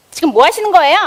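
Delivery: loud, high-pitched challenge; acted yell. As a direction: yell it as an indignant confrontation. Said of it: "라고 소리 질렀을까요".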